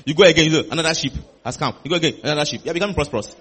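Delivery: ordinary delivery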